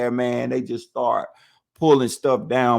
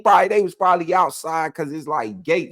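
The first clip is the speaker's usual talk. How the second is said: ordinary delivery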